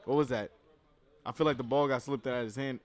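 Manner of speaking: usual speaking voice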